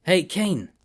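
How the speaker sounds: hey Kane yelled to get his attention; shout. A narrator's reading. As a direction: shout his name across the room. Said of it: "I shouted at him".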